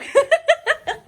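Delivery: loud, high giggle; laugh. Real, not acted. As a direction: laugh at your own teasing. Teasing her husband.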